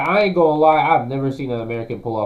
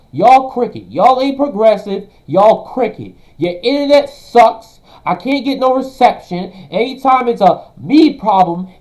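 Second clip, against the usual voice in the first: loud, higher, yelling insults; furious rant; 6 semitones higher, 7 dB louder than usual. Real, not acted. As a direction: rip into them at full volume. Streamer ranting at critics.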